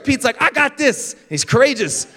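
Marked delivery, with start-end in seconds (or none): 0.4-1.1 high-pitched, bold declaration; acted plucky confidence; mock courage. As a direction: announce it like an eager volunteer. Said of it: "one of them responds with courage".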